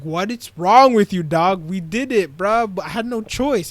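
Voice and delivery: high, loud mock arguing; acted frantic protest. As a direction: argue back loudly, acting worked up. Acts out frantic quarrel after killing.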